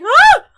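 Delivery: sudden high excited shriek; excited squeal. Retold or imitated. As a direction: squeal with pure delight. Retold squeal over birthday song.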